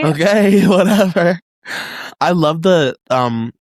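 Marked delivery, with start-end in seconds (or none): 0.0-1.4 laughing whatever. Acted. Mock resigned reply, laughing.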